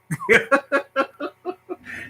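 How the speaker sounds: high rising giggle; high laugh. Real, not acted. Laughing at getting punched in sixth grade, high giggle. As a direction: let out a string of high chuckles.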